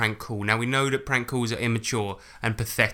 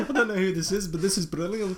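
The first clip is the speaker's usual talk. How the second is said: higher, laughing mock South African accent; bad South African accent; 6 semitones higher than usual. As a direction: mimic the caller in terrible South African. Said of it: "That's the worst South African accent".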